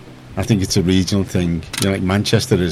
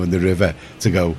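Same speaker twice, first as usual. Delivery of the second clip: ordinary talk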